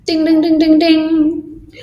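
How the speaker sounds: high sing-song ding ding; mock bell. Shown as a demonstration. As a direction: chime it out like a little bell. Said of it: "Full stop".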